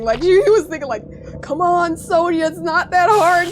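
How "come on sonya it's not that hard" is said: higher, louder mock exasperation; drawn-out, pleading mock protest; exasperated coaxing. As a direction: imitate a brother loudly egging you on. Retelling brother's exasperated thoughts about her.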